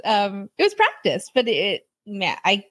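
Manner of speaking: normal talking voice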